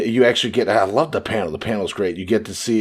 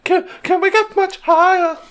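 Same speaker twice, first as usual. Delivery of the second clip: mock high voice; 4 dB louder than usual. Mocking a high-pitched line.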